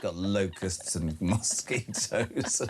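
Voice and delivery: low, slow, giggling jinx on a friend; teasing hex dissolving into laughter; laughing through a mock curse. Real, not acted. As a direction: curse him while cracking up.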